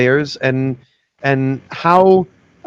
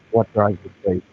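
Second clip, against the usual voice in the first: muffled and distant, softer; 5 semitones lower, 4 dB quieter, faster than usual. Real, not acted. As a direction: ask through a muffled connection. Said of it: "you're kinda muffled there".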